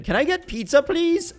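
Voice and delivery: high-pitched wheedling request; playful pleading. Pretend. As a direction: beg sweetly, voice climbing right up. Plays asking husband for pizza.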